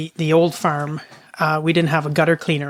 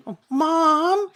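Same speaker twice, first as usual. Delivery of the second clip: put-on high, timid child's call to mom; imitated worried child; 11 semitones higher than usual. Child peeps out the barn door, high imitated kid voice. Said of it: "like, peep out and I".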